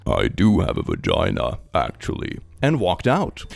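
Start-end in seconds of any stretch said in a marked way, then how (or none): 0.1-2.4 much lower, deadpan, fading to a whisper; deepest voice. Retold or imitated. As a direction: drop your voice as deep as it goes. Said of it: "said in my deepest voice".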